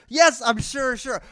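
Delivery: high, loud exasperated yes; worked-up mock impatience. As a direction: insist loudly to a nagging app. Exasperated reply to nagging app.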